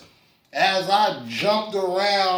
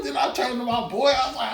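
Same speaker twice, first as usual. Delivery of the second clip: ordinary talk, nothing marked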